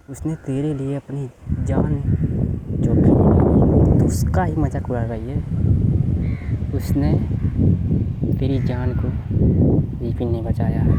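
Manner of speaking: loud, furious rebuke; angry scolding. Acted. Hema's friend scolds her for laughing at Vipin, loud and angry. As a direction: tear into her for mocking him.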